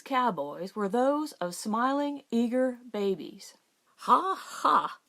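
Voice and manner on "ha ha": big hearty ha ha, loud and high; roaring laugh. A narrator's reading. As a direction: laugh out loud like a jolly rancher. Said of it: "roared Stillwell".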